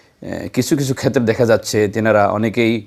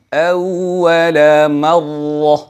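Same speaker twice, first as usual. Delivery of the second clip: slow melodic chant of আওয়াউ ওয়া নাসারু; Quranic recitation; 3 semitones higher, 3 dB louder, much slower than usual. Shown as a demonstration. Teacher recites an example verse for viewers, chanted slowly. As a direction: recite it slowly with a rounded waw. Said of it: "তাসদিদের সময়".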